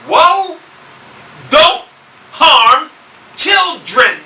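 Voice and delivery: high, loud, with pauses between the words; stern warning. Acted. Preacher voices Yahushua's command about children, stern warning. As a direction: proclaim it word by word, forcefully.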